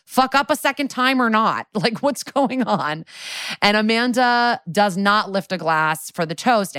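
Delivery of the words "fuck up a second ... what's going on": mock disbelief. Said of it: "And it's like".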